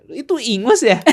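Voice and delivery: high, loud giggling; real laughter; laughing. Real, not acted. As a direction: laugh while teasing. Laughing about tears.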